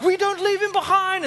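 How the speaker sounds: high, hammed-up heroic vow; heroic firefighter. Imagined firefighter rescuing a colleague, high and hammed-up. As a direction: say it like the hero in a war film. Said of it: "They'd be like".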